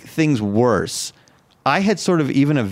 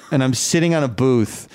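normal talking voice

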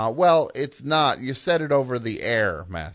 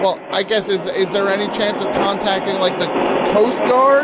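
loud, high, desperate shout; 6 semitones higher, 6 dB louder than usual. Acted. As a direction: yell over the roaring water. Shouting over the flooding.

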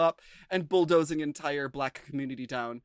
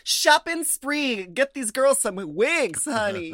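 higher, louder, slow hype; excited hype; 8 semitones higher, 6 dB louder, slower than usual. Real, not acted. Hyping the wig prize to the girls, excited and slow. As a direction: stretch out the prize like a cheer.

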